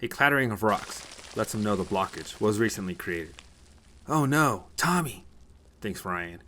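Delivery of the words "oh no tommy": high, loud cry of dread; alarmed. Acted. Ryan realizes his brother is in danger, alarmed. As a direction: exclaim in sudden alarm.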